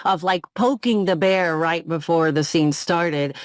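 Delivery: ordinary talk, nothing marked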